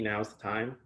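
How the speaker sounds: ordinary delivery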